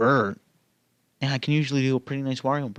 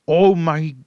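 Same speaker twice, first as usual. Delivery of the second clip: loud, higher startled exclaim; surprised; 4 semitones higher, 7 dB louder than usual. Real, not acted. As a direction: caught off guard by his own voice. Reacting to own voice.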